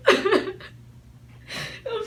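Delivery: real crying, words dragged out very slowly. Real, not acted. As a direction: force each word out through sobs. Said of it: "That's three people crying".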